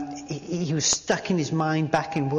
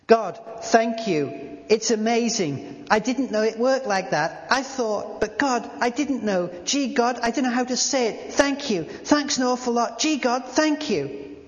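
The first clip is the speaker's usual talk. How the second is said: higher, overwhelmed, gushing thanks; tearful thanks; 7 semitones higher than usual. Acted. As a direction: pour out thanks, close to tears. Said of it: "he began both to cry and pray".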